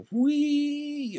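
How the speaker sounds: high, delighted squeal of joy; a fire engine squealing with joy; gleeful whee. Acted. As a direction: let out a thrilled high whee.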